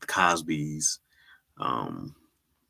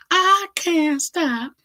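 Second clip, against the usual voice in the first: squeaky high, slow mock whine; mock friend voice; 8 dB louder, much slower than usual. Mocking Dion who can't stop going live, high squeaky whine.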